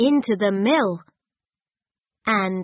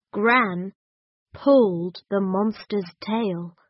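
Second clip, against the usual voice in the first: plain reading voice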